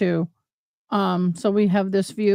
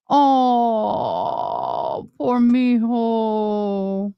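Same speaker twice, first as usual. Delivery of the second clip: tender pity; much slower than usual. Real, not acted. Premature baby in hospital.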